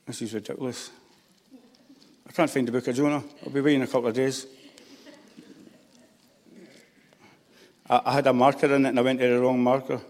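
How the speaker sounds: frustrated mutter under breath; quiet muttering. Real, not acted. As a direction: grumble to yourself while searching. Can't find Jonah, muttering.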